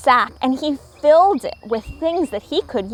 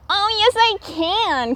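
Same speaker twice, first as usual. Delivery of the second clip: eager cry; 8 semitones higher than usual. A narrator's reading. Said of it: "cried Little Raccoon".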